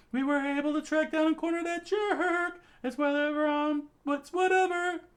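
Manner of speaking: high loud voice, cheerful praise; Andy calling out. Acted. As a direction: shout praise to Fuko. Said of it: "Andy screamed out".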